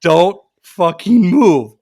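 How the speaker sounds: slow, emphatic retold command; slow, insistent mock scolding; sterner repeated warning. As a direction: re-enact laying down the law, word by word. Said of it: "like a child that you know is gonna misbehave".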